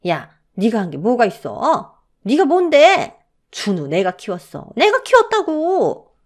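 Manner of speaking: shrill high scolding mother-in-law; mother-in-law's abuse. A narrator's reading. Mother-in-law berating her daughter-in-law, angry. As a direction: spit the words out with contempt. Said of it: "시어머니는 저에게 악담을 퍼부었습니다".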